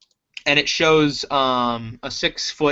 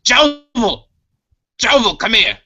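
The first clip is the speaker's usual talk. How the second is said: loud high call across the room; 8 semitones higher, 5 dB louder than usual. Acted. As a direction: shout for him to come back. Boss calling out for Jovel.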